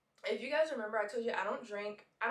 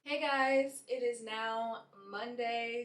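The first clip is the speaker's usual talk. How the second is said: normal talking voice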